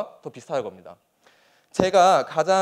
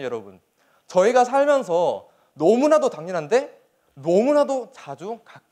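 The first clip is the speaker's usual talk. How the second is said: usual speaking voice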